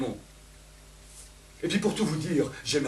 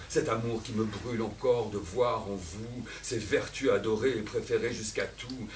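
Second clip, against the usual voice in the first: ordinary talk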